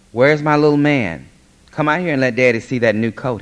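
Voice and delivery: loud, high fatherly holler; proud calling out. Acted. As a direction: call your little boy in from the next room. Proud father calls his son in.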